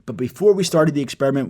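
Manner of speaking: normal talking voice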